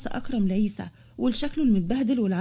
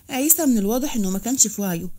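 ordinary delivery

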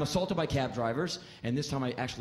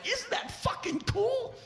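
much higher, slow, gushing; thrilled; slower than usual. Retold or imitated. Comic gushes to the driver about the cobra, very high. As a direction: share the thrill of the snake.

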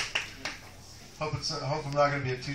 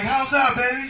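higher, louder lover's query; eager check-in; 8 semitones higher, 6 dB louder than usual. Retold or imitated. Standup voicing himself asking his lady in bed, eager and raised. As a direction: push the question, hopeful. Repeated bedroom line.

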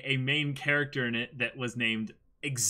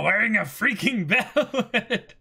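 laughing; 9 semitones higher, 8 dB louder, slower than usual. Real, not acted. Laughing at the chat comment.

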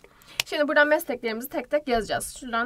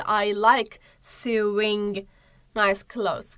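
slow example; 2 semitones lower, much slower than usual. Shown as a demonstration. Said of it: "example sentence read slowly".

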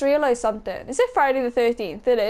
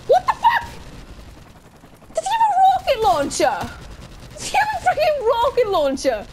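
very high-pitched disbelieving shriek; shocked squeal; 12 semitones higher than usual. Real, not acted. Shocked outburst at rocket launcher.

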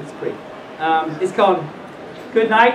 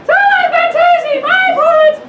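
very high, loud squeak; piercing falsetto baby bear; wee little baby bear voice; 6 dB louder than usual. Acted. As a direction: squeal it like an outraged tiny bear. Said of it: "in his wee little voice".

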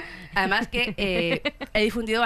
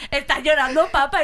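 teasing dad; 6 semitones higher, 6 dB louder than usual. Retold or imitated. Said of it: "Siempre, siempre".

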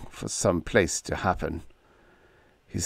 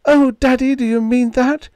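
high, loud, joyful hope; high thrilled cry; 7 dB louder than usual. A narrator's reading. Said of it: "she cried".

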